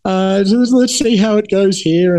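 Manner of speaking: imitating a vague non-answer to dodge the question; half-hearted answer. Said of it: "I gave him a pretty half-hearted answer".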